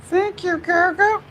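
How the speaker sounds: tiny, very high comic falsetto; squeaky astonishment. Retold or imitated. Maker retelling his leather discovery, squeaky mock amazement. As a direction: squeal it in a silly put-on voice. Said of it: "And I was like".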